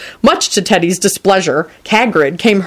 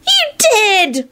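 put-on very high, drawn child's wail. A narrator's reading. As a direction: wail the accusation like a small boy. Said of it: "wailed Teddy".

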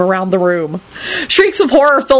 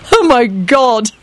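wheezing; 3 semitones higher, slower than usual. A narrator's reading. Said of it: "George wheezed".

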